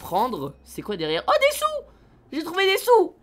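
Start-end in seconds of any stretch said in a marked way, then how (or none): 1.3-3.2 high, delighted cry of discovery; excited squeal. Real, not acted. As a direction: squeal over the found money. Finds money in the car.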